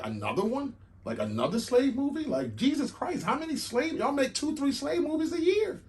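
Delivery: high-pitched, incredulous griping; exasperated complainers. Acted. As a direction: voice the fed-up audience, pitch way up. Voicing people fed up with movies.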